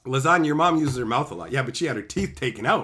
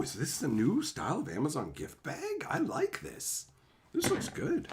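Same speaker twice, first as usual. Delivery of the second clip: high, soft wondering voice; curious gift opening; 10 semitones higher, 5 dB quieter than usual. Real, not acted. Unboxing a present on stream, high soft delighted coo.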